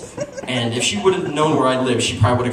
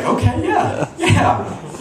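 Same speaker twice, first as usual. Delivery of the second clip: eager yes; 11 semitones higher, much slower than usual. Retold or imitated. Eager reply to the bikini offer.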